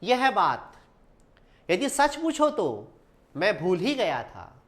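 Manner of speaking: slow, with long breaks after बात and तो; pauses at commas. Shown as a demonstration. Teacher reads a comma example slowly, pausing at each comma. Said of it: "अल्प विराम का प्रयोग करते हैं".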